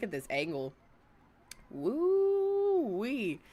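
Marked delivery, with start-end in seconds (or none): 1.7-3.4 long, high swooping whoop; high exclamation falling at the end. Real, not acted. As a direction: let out a drawn-out woo-wee. Exclaims at food on screen.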